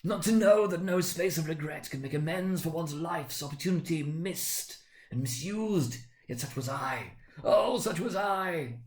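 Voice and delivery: a ghost mourning wasted life; mournful ghost. Acted. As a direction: lament like a doomed spirit. Marley's ghost laments.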